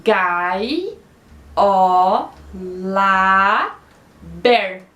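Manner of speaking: "Gai", "ó", "lá", "ber" as separate low, strong beats; "Gai, ó, lá, ber" with a pause after each; counting syllables. Shown as a demonstration. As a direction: sound out "Gai, ó, lá, ber" one by one, low and held. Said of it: "vamos contar quantas sílabas poéticas".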